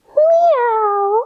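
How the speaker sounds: high, loud little meow; cat meow. Shown as a demonstration. Teacher showing kids the cat sound, high meow. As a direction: mew like a cat. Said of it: "And to cat".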